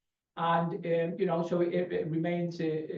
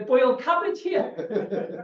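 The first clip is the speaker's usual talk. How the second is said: laughing; 6 semitones higher, much slower than usual. Real, not acted. Joking about boiled cabbage.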